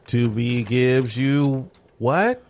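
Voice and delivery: slow, stretched ad callout; drawn-out announcement. Shown as a demonstration. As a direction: stretch it like a teaser. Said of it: "'cause I was gonna shout it".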